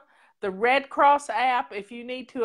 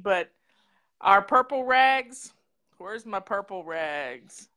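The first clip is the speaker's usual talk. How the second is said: ordinary talk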